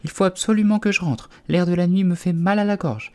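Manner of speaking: put-on high-pitched fussy old bird; high, put-on old-lady voice; muttering old magpie. A narrator's reading. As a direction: fret aloud as an elderly magpie. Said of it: "Une vieille pie ... en marmonnant".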